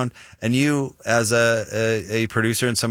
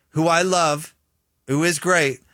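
ordinary talk

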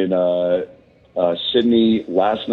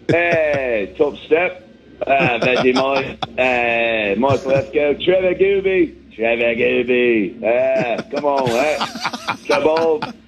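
hammed-up Aussie twang, pitched up; mock Australian accent; 4 semitones higher than usual. Comedian greeting podcast hosts, hammed-up Australian accent. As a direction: play the jokey Aussie bloke saying g'day. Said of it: "picked up a little bit of an accent".

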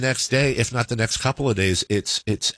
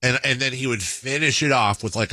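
ordinary talk, nothing marked

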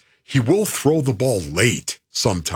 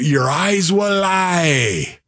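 slow, lightly Scottish warning; a hint of the old Scottish coach; mild Scottish accent, drawn-out; 7 semitones higher, much slower than usual. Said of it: "I picked up on his accent through your impression".

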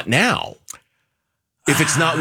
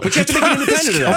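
excited, squeaky insistence; exasperated laughing repeat; 13 semitones higher, much faster than usual. Real, not acted. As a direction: blurt it out half laughing.

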